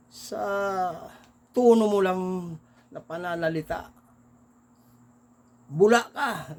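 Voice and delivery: loud, high accusing bark. Retold or imitated. Naga man shouts out the Bula passenger, accusing. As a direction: catch him out and shout it.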